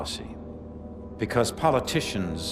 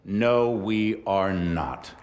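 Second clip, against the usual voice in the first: slow, lowered, deliberate denial; measured denial; 6 semitones lower, much slower than usual. Acted. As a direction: let each word land, dropping in pitch. CEO pauses on his denial.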